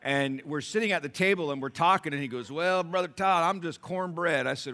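loud, high preacher's opening; preaching at full force. Acted. Preacher telling the congregation a story, loud and high.

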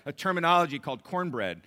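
loud, high preacher's opening; preaching at full force. Acted. Preacher telling the congregation a story, loud and high.

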